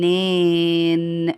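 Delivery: low, very slow, stretched syllables; drawn-out dictation. Shown as a demonstration. Teacher giving pupils a new word, drawn out. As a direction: hold each vowel long and low.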